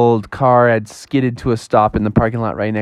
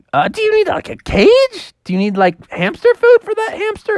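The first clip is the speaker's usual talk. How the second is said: very high apologetic mother; high mom voice. Acted. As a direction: play the flustered mom up high. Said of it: "Froggy's mom was like".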